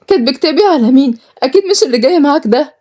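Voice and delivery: high-pitched incredulous questioning; shocked disbelief. Acted. Sister told she marries today, shocked.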